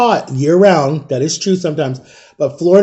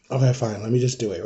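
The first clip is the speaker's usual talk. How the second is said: soft, lower-pitched giving in; grudging surrender; 5 semitones lower, 10 dB quieter than usual. Retold or imitated. Child giving in to the nurse's treatment, quietly resigned. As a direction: give in quietly, like a reluctant kid.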